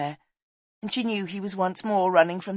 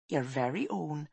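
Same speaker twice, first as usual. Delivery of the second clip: warm reassurance; 7 dB quieter than usual. Acted. Woman assures boy the room is his, tender. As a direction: confirm it gently, settling the matter.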